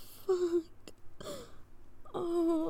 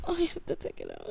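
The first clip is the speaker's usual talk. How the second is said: fast whisper; 3 semitones higher, faster than usual. Real, not acted. Rushed whisper.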